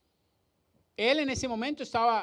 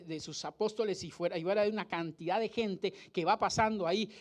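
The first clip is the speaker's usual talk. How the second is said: ordinary delivery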